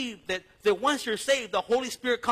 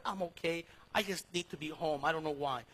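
soft reassurance; 4 semitones lower, 7 dB quieter than usual. Retold or imitated. Answering wife on phone.

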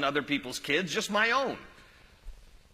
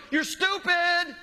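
put-on shrill high child's scream, drawn out; kid yelling; 13 semitones higher, 4 dB louder, much slower than usual. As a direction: scream it like a bratty kid in a tantrum. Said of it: "yelling over, over the shelving".

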